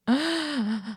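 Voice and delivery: high, soft gasp; sudden intake of breath at the sight; shocked gasp. Real, not acted. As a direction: gasp on seeing something startling. Shocked on seeing the plush picture.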